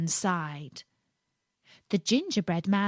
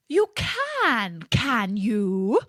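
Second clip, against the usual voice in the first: a light yelp of triumph; yelping fox; 2 semitones higher, 4 dB louder, much slower than usual. A narrator's reading. Fox yelping just before snapping him up. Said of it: "yelped the fox".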